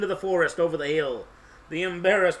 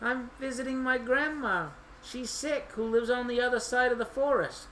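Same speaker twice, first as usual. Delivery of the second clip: put-on high, softer child voice; little girl explaining; 6 semitones higher, 3 dB quieter than usual. A narrator's reading.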